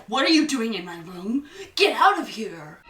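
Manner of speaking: loud, fast angry demand; angry yelling. Acted. Herbert West catches Meg in his room, loud angry yell. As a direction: yell at the intruder in your room. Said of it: "He's like".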